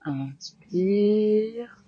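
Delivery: firm, clear cues over the breath; yoga class cueing. Acted. Yoga teacher guiding students through sun salutations, firm cues. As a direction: call out each pose and breath to the class.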